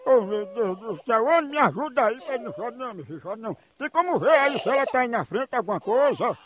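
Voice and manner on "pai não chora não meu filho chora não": put-on hushing a baby. Prank host softly hushing the fake crying baby on the phone. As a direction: croon it to a pretend crying baby.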